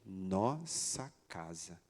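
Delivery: very slow, low and soft, word by word; insistent child, imitated. Daughter at the door wanting to go home, quietly insistent.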